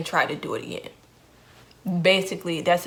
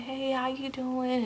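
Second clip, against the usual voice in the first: friendly patient; 6 semitones higher, slower than usual. Retold or imitated. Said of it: "She was saying".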